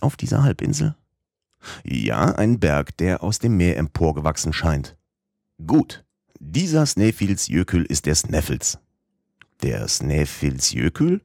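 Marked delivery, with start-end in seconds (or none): none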